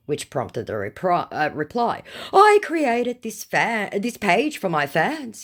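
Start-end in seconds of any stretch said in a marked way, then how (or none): 2.3-5.4 high, loud, drawn-out put-on voice; mock indignant scammer. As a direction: ham up the fake star's self-importance. Fake star defends his page.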